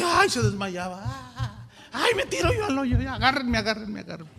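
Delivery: a mourner throwing herself at the grave, overdone; mock wailing mourner. Mourner pretending to faint.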